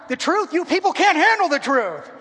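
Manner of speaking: hammed-up movie line; movie quote. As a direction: belt it like the famous film line. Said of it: "I talk using movie lines".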